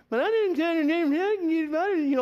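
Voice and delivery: high, loud, whiny mimicry of a complaining young man; whiny Luke Skywalker. Preacher mocks Luke Skywalker's complaining, high whiny voice. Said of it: "like, he's just whiny".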